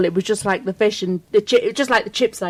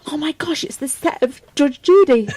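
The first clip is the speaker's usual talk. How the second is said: retold excitement; 6 semitones higher than usual. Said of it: "I was like".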